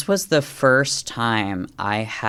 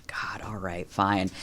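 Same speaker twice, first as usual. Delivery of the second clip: whispered, reluctant giving in; grudging mutter; 2 semitones lower, 8 dB quieter, slower than usual. Retold or imitated. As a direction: sigh it out like you've lost the argument. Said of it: "I said".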